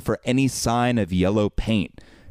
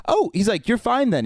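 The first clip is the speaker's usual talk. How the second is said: sudden realization; 12 semitones higher than usual. Retold or imitated. Translator suddenly understands.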